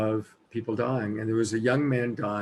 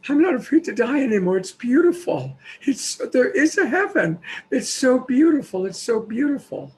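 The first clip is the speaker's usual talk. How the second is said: high, loud overjoyed outburst; reliving the young man's joy in a rush; ecstatic awe; 12 semitones higher, 6 dB louder than usual. Retold or imitated. Storyteller voicing the dying man's joy, high and loud.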